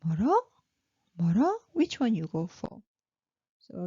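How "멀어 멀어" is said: 멀어 lifted at the end to ask; question rise. Shown as a demonstration. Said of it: "is it far".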